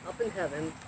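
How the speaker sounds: ordinary delivery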